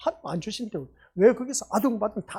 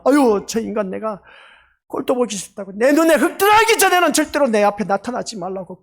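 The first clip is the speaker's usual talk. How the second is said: angry parent yelling; 3 semitones higher, 8 dB louder than usual. Retold or imitated. Parent shouting at a disobedient grown child, enraged. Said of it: "그렇게 막 소리 지르면서 화를 내는".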